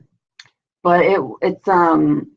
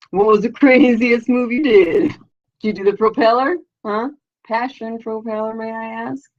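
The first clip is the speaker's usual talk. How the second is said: cheeky questioning; 6 semitones higher than usual. Acted. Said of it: "do you talk like that with them".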